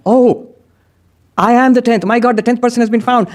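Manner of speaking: high, joyful realization; delighted discovery. Acted. The counting man realizes he is the tenth, joyful. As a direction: burst out in happy wonder.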